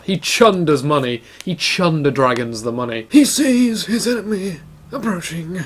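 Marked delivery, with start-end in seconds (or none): 3.1-5.6 hushed, higher documentary voice; nature documentary narrator. Pretend. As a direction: narrate the hunt like a wildlife film. Mock documentary narration again.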